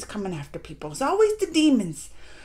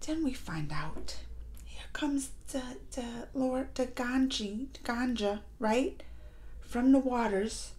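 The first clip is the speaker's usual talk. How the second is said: hushed reveal; 3 semitones lower, 11 dB quieter, slower than usual. Real, not acted. Dramatic reveal of the Lord Ganja.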